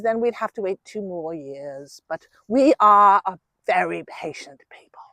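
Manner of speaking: documentary narrator ending in a whisper; David Attenborough. Pretend. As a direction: hush it down like a wildlife film voiceover. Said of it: "David Attenborough accent".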